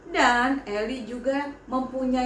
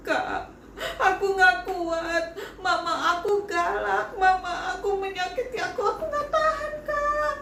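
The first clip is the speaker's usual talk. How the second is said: higher, sobbing complaint; child crying to her Sunday school teacher; 7 semitones higher than usual. Acted. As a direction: break down to the teacher, voice climbing high. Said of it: "Eli udah bilang cry".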